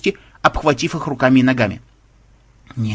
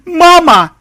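loud, high yelp of alarm; startled high-pitched shout; panicked cry; 9 dB louder than usual. A narrator's reading. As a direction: cry out for mama in sudden fright. Programmer grabbed a crocodile, cries out.

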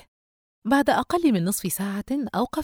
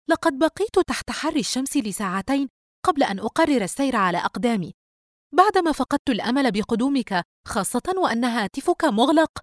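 higher, louder indignant sister; indignant complaint; 7 semitones higher, 5 dB louder than usual. A narrator's reading. Sister to late brother after waiting in the sun, indignant. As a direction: voice an exasperated reproach.

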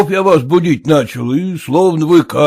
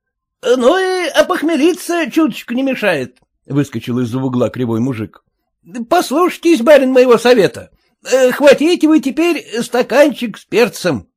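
high, wheedling peasant; high sly peasant voice; one-eyed peasant voice; 11 semitones higher than usual. Acted. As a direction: coax the master toward a drink, up high. One-eyed peasant gives advice.

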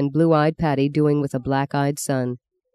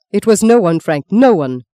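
raised, loud insistence; urgent cry; 4 semitones higher, 6 dB louder than usual. A narrator's reading. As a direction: cry out to pull him back. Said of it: "the priest cried, trying to pull him back".

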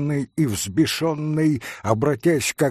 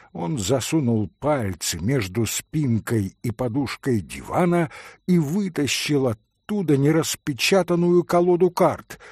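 ordinary delivery, nothing marked

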